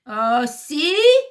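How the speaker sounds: put-on high loud accented roar; first henchman roaring back, heavy accent. A narrator's reading. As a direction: roar in outrage, thick accent. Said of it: "roars the, the first man".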